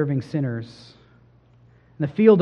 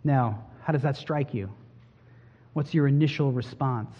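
ordinary talk, nothing marked

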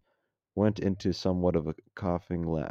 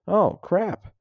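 high, louder "uh-oh" moment; alarmed realization; 13 semitones higher, 7 dB louder than usual. Acted. Soldier realizing he faces a five-star general, alarmed. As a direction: blurt it as the penny drops.

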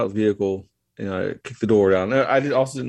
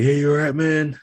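voicing the ATV guy a bit higher, louder; concerned stranger; 7 semitones higher, 3 dB louder than usual. ATV driver calling to the crash victim, lightly voiced concern. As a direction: call out to a hurt man, lightly acted.

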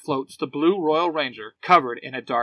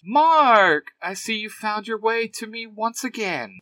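higher-pitched villain greeting, name called out; taunting villain; 7 semitones higher, 3 dB louder than usual. A narrator's reading. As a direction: greet an old foe tauntingly. Villain greets her returning enemy.

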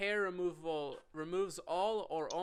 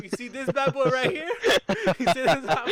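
loud, high bragging bro voice, laughing; frat bro; 7 semitones higher, 11 dB louder than usual. Retold or imitated. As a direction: show off the scar like a cocky bro. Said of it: "Brayden's like".

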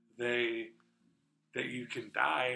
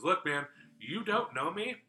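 slow, higher and louder, pleading; earnest plea; 5 semitones higher, 8 dB louder, slower than usual. Retold or imitated. Retelling a woman telling a stranger, slow and pleading. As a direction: lean in and say it slowly, like it matters.

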